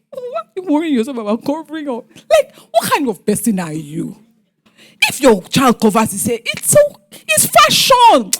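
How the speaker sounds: high, exaggerated voice of the husband; mocking the father. Wife imitating the father dismissing her worry, hammed-up and high. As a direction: ham up his dismissive tone, stretching the end. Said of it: "the father is saying".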